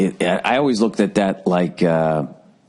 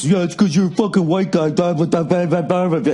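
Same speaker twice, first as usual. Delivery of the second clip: mock whiny critic, higher and pushed; lightly voiced scolding critic, then trailing blahs; 9 semitones higher than usual. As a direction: parrot the hecklers, higher and sneering. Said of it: "I got people giving me shit".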